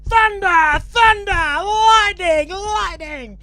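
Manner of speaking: high, full-volume drawn-out yell; wildly overdone high-pitched shouting; shouted lightning call. Acted. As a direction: bellow it like a madman mid-joke. Man kicks bed, shouts weather words.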